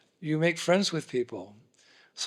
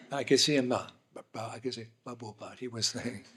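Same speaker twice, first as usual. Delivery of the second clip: ordinary talk, nothing marked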